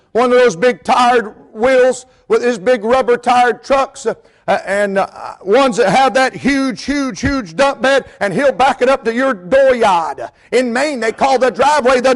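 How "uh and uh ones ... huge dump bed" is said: normal talking voice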